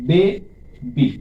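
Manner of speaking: Baby very slowly, বে and বি held apart; two deliberate beats in baby for a learner; split into syllables. Shown as a demonstration. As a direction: pause clearly between বে and বি in baby. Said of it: "দুইবারে উচ্চারিত হচ্ছে".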